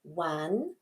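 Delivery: soft, lilting count, each number rising; quiet teacher's count for a young child. Shown as a demonstration. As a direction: count along softly, pausing after each number. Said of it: "you could ask your child".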